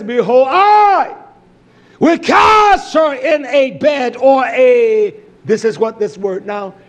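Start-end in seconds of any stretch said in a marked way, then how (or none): 0.0-5.1 loud, high, drawn-out proclamation; booming quotation. Acted. Preacher quoting the divine warning to his congregation, booming. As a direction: thunder it out, stretching the key words slowly.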